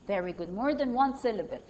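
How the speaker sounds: ordinary talk, nothing marked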